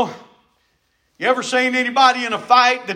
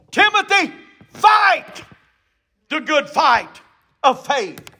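urgent command; 2 semitones higher than usual. Acted. Paul ordering Timothy to fight, shouted command. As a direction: call out an order, each word standing alone. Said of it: "It was a command".

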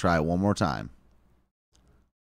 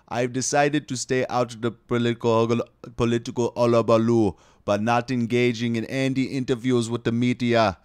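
loud, hammed-up Jamaican voice; exaggerated Jamaican impression, raised and forceful; mock Jamaican accent; 4 semitones higher, 6 dB louder than usual. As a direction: read the statement out in a put-on Jamaican accent, louder. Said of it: "do a Jamaican voice".

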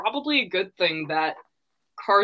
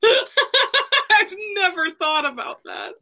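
laughing; 13 semitones higher, slower than usual. Real, not acted. Laughing at a funny realisation.

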